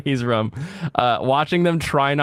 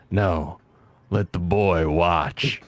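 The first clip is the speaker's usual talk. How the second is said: quoting comedian; 8 semitones lower, much slower than usual. Retold or imitated. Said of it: "the comedian who does the".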